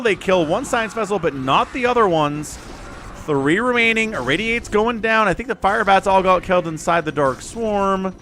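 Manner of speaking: loud, urgent game casting; excited play-by-play. Real, not acted. Caster calling a drop on the science vessels, excited play-by-play.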